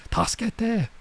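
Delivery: put-on high-pitched little girl crying help; mock cry for help. Girl in trouble calling for help, high mock cry. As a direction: squeak it out like a child calling for help. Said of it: "She's yelling for help".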